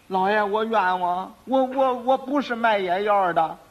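slow, stammering, plaintive denial; cowering protest. Acted. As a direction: plead innocence haltingly before the judge. Frightened quack protests his innocence.

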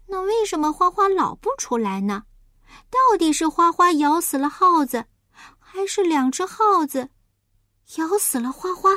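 high piglet voice, puzzled; anxious little pig. Acted. Little pig waits for Huahua to appear, anxious. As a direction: worry aloud, pitched well up.

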